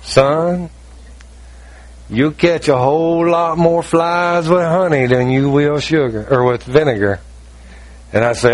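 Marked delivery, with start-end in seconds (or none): 0.0-7.2 slow, lightly Southern pastor voice; a hint of a Southern preacher drawling advice; Southern accent, gentle and slow. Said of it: "and he's Southern".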